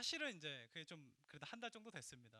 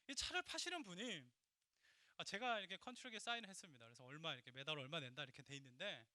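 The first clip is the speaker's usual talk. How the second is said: normal talking voice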